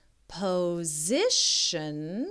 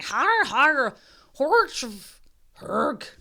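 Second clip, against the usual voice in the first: high, loud mock villain laugh; big evil laugh; 6 semitones higher, 6 dB louder, slower than usual. A narrator's reading. The Norwegian dinosaur's loud evil laugh. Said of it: "sounded like a big evil laugh".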